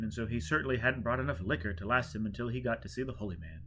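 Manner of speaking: plain reading voice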